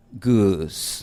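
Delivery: goose with a long oo ending in a w glide; English goose. Shown as a demonstration. Teacher modelling English goose for Spanish learners. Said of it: "de la palabra".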